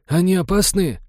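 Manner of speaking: high-voiced, timid-sounding question; naive crewman. A narrator's reading. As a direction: ask in a high, innocent voice. Naive crewman asks about feathers.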